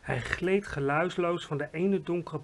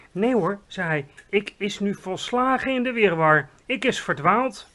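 louder, flustered big friendly giant; a giant confessing he's lost; lightly voiced worried giant; 4 semitones higher, 7 dB louder than usual. A narrator's reading.

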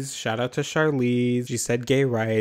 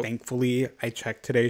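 ordinary delivery

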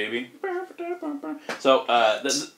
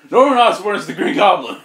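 loud mock announcement, higher pitch; bursting in accusing; 7 semitones higher, 8 dB louder than usual. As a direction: barge in and blurt it out. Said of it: "he busts in".

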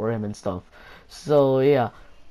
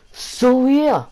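voice crack; 10 semitones higher, 6 dB louder than usual. Real, not acted. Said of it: "my voice crack that I clearly heard".

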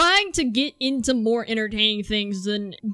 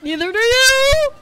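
loud, high-pitched yell; 12 semitones higher, 8 dB louder than usual. Real, not acted. Gamer retorting to another racer, high yell. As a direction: shout it up high at a rival.

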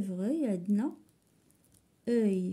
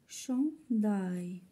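syllable by syllable. Shown as a demonstration. Teacher counting syllables for pupils, syllable by syllable. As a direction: say شونداي with a gap between its two syllables. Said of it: "نشوفوا شحال عندنا من السيلاب".

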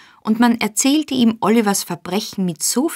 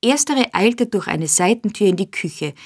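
ordinary delivery, nothing marked

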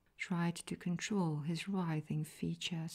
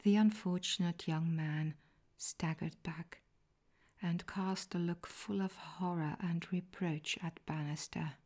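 usual speaking voice

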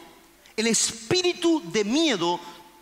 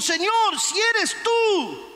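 high, drawn-out call; acted bold, high-pitched appeal; Peter calling out; 9 semitones higher, slower than usual. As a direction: call boldly across to the Lord. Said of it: "Pedro entonces dijo".

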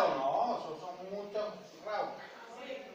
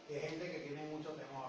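very soft, lower voice; timid hush; 7 semitones lower, 15 dB quieter than usual. Retold or imitated. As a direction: drop to a timid near-murmur. Mimicking timid, doubting sellers.

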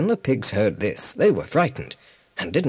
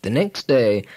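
ordinary delivery, nothing marked

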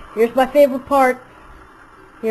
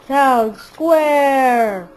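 ordinary delivery

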